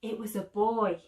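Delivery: usual speaking voice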